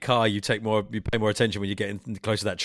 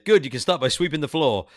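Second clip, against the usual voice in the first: raised, louder quoted put-down; dismissive comeback; 5 semitones higher, 6 dB louder than usual. Acted. Film boss replies to the would-be mayor, dismissive comeback. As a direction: answer the boast with a punchy put-down. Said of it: "And he goes".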